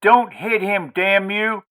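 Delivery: sharp high cry to stop him; angry cry. Acted. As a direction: yell it to protect her son. Wife stops husband hitting son.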